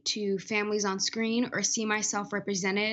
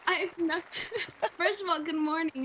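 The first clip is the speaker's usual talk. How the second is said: laughing; 9 semitones higher than usual. Real, not acted. Guest laughing, denying tears.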